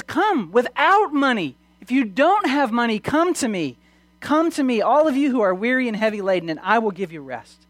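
high, urgent preacher's call, easing at the end; impassioned preaching. Acted. Preacher calling the weary to come to Jesus, impassioned. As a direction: proclaim the invitation with force.

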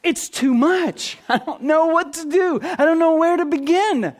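light nervous laugh, overwhelmed; overwhelmed newcomer. Retold or imitated. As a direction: voice a new believer swamped and unsure where to begin. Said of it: "you're thinking".

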